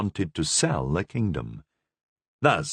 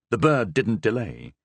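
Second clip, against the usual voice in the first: usual speaking voice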